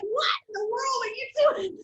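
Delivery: high laughing shout; kids' shout. Retold or imitated. Kids shouting at mom in disbelief, laughing. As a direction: yell it in disbelief. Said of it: "they're like".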